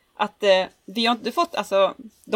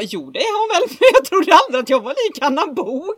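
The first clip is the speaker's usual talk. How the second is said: laughing; 9 semitones higher, 4 dB louder than usual. Retold or imitated. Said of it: "Jag bara".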